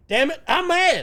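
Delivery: squawky cartoon duck, high and loud; furious cartoon duck tantrum. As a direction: sputter it like an angry cartoon duck. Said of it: "in the watch the duck sense of".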